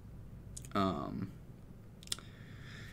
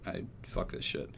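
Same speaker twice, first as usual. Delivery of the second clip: whispered; 4 semitones lower, 11 dB quieter than usual. Retold or imitated. Quitting, fed up.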